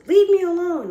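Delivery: loud, high mock yell; acted outburst at a cold caller; angry rebuff. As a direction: cut the caller off with a shout. Person called snaps at cold caller.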